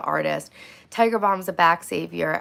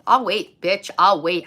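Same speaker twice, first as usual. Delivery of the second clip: defiant mock threat; 7 dB louder, slower than usual. Defiant retold stand-off.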